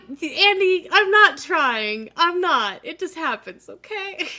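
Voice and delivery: high, loud laughing protest; excited protest. Real, not acted. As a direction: defend yourself with a squeaky laugh. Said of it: "host defends his accent".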